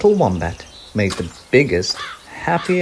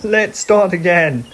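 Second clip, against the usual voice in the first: laughing Koala; 9 semitones higher, 6 dB louder than usual. Acted. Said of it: "laughed Koala".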